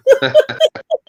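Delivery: 1 high cackle. Retold or imitated. Mimicked guy cracks up.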